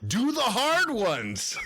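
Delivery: high, loud and slow; urging. Acted. Emil demands the hardest wishes, slow loud urging. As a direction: press them hard, drawn out and loud. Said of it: "urges Emil".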